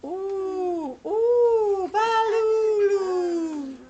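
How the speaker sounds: high, drawn-out spooky ooh; eerie wailing sound. Acted. Reader making the strange sounds for a child, eerie. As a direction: wail it out high and long.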